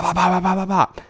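high quick pop pop pops; playful high popping noise; popping sounds. Retold or imitated. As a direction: pop each one out high, like bubbles bursting. Sound effect of life popping.

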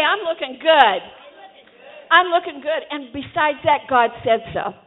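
loud, emphatic self-affirmation; rousing declaration. Shown as a demonstration. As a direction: proclaim it boldly for the crowd to echo. Preacher models affirmation for congregation.

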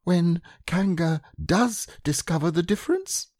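high-pitched anxious little questioner; small Piglet voice. A narrator's reading. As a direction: ask it in a tiny, high character voice. Piglet questioning Rabbit's plan.

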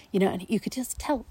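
ordinary talk

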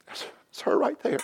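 hushed aside; tender whispered reveal. Real, not acted. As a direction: breathe it to the crowd like a secret. Pointing out his wife Dana.

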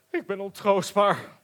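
high, loud wailing lion; a sobbing lion's lament; crying. A narrator's reading. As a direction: wail it out in tears. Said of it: "huilde hij".

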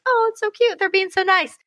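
high, delighted gush; mock-sweet high gush. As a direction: coo over the kids being sweet. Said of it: "I'm like".

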